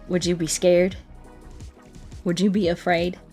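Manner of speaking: usual speaking voice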